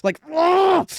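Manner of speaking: very high, loud anguished "ah"; frustrated cry. Real, not acted. Fan crying out in frustration over the knockout. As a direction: let out a strangled yell of frustration. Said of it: "last crash out".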